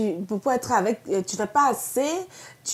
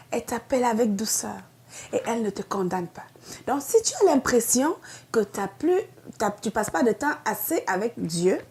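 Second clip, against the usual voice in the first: ordinary delivery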